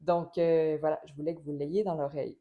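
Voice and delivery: usual speaking voice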